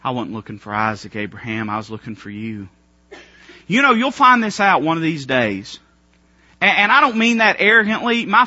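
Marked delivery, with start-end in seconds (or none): 0.0-2.7 much lower, much softer, gentle; a hushed, tender God speaking. Retold or imitated. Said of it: "he said".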